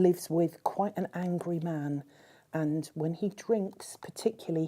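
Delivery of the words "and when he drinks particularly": usual speaking voice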